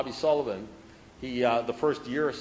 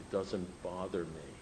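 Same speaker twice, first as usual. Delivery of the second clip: much softer, lower, unbothered; quiet, calm reply; 9 semitones lower, 14 dB quieter than usual. Retold or imitated. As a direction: gently let it land. Sponsor's calm closing line.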